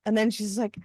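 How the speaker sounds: ordinary talk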